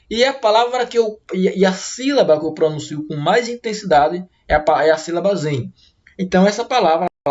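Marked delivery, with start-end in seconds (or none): none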